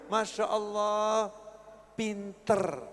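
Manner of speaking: slow, soft, admiring; soft slow doting exclamation; fond parent praise. Retold or imitated. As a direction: praise the clumsy child tenderly. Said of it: "apa kata orang tua dulu ya?".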